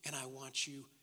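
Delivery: very soft, low, with pauses; hushed tenderness. Acted. God reassuring those who feared him, hushed and tender. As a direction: speak forgiveness gently, sinking to a whisper. God's tender words, sermon close.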